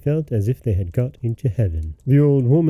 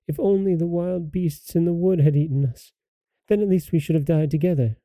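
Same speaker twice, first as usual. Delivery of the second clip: put-on girl crying out; 6 semitones higher than usual. A narrator's reading. Poor little sister praying for help, high plaintive cry. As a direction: plead in a high, softened child's voice. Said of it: "she cried".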